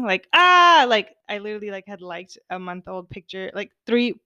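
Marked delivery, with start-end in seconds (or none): 0.3-0.8 a high, loud "Ah!" of panic; mock scream. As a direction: squeal it out like inner panic. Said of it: "in my mind, I was screaming".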